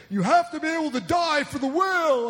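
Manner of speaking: high, loud overwrought activist; mock activist zeal. Mocking a Whale Wars activist, loud and overwrought. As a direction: lampoon a fervent whale defender. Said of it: "when you get this guy saying".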